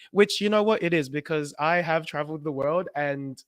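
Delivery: ordinary talk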